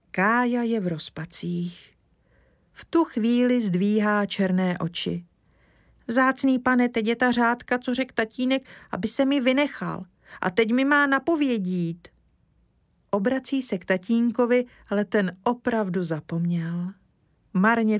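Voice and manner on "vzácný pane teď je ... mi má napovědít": put-on high, eager child's voice; little boy. Kája explaining to Mr Lesní, high childlike voice. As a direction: speak up like an earnest small boy.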